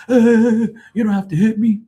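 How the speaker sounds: high, loud yelp of mock panic; mock scared guy. Drunk guy fleeing the DJ's swing, high panicked mock yelp. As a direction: imitate a coward squealing as he flees. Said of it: "And he took off. He's like".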